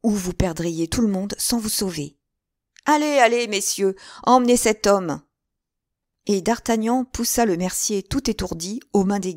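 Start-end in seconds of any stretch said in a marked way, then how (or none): 2.8-5.2 commanding. A narrator's reading. Feigned order to the guards.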